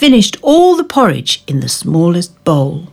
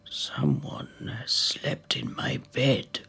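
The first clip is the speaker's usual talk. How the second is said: whispered father bear; 4 semitones lower, 15 dB quieter than usual. Acted. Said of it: "growled Father Bear".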